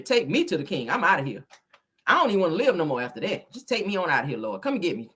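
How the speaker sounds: usual speaking voice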